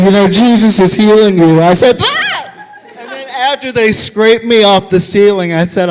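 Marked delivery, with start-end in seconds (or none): 0.0-1.6 loud, quick imitated proclamation; pushy faith healer, full volume; in-your-ear zealot. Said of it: "This lady was in my ear". 2.0-2.7 very high shriek; short hammed-up yelp of fright; startled scream. Startled by lady in his ear.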